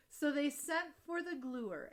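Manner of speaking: plain reading voice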